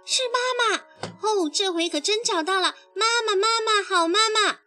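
high-pitched little tadpoles calling for mama; excited calling out. A narrator's reading. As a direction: call out in a high child's voice, as if mother is found at last. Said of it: "他们喊道".

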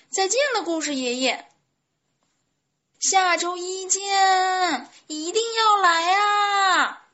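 high, loud chick shouting farewell; calling out. A narrator's reading. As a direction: shout it after someone leaving, drawing out the endings. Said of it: "卡梅利多喊道".

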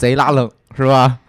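loud northeastern dialect phrase; northeastern Chinese accent. Pretend. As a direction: Dongbei slang, said loud. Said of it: "这期节目就用东北话聊吧".

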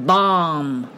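bomb drawn out with silent final b; explosion-like bomb. Shown as a demonstration. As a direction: let bomb boom, ending on M. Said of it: "模拟爆炸声音是bomb".

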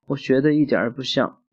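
ordinary delivery

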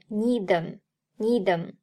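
"need them" with the d of "need" unreleased; dropped plosive. Shown as a demonstration. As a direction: join "need them", swallowing the d. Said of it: "失去爆破".

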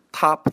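"top" said like "tap" with an open ah; ah sound. Shown as a demonstration. Teacher shows what listeners mishear, "top" with ah sound. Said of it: "那个啊的音".

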